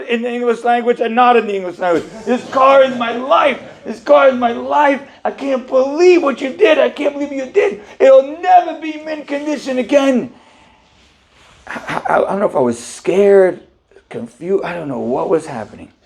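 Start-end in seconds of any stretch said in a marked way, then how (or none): 2.2-10.3 livid. Retold or imitated. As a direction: plead his car is everything. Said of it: "He was livid".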